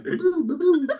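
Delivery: high-pitched video-game noises; Doctor Mario. Retold or imitated. Prophet turned game character firing away, playful noises. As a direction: imitate bouncy game sound effects. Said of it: "he turns into Doctor Mario".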